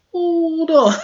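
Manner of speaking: high laughing "hold on"; laughing disbelief. Real, not acted. Laughing at the hypocrisy.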